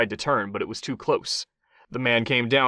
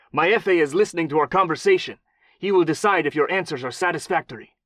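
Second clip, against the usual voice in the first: put-on menacing, higher thick Mexican accent; 5 semitones higher, 4 dB louder than usual. A narrator's reading. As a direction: threaten in a heavy Mexican accent. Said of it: "thick, false Mexican accent".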